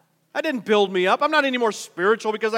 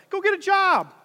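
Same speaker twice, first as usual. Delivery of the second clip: higher and a bit louder, exasperated retort; fed-up parent; 9 semitones higher, 4 dB louder than usual. Retold or imitated. Parent tells bragging adult child to work, exasperated. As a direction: snap it back at a freeloading grown kid. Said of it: "You're like".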